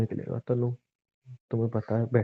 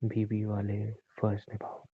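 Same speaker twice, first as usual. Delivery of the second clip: slow, soft and threatening; 4 dB quieter than usual. Acted. Husband coldly ordering his wife to do her duty, menacing. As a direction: say it like a quiet threat.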